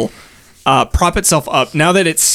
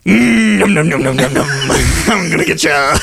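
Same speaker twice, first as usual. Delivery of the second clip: dragon voice. Acted. Dragon pretends to eat them.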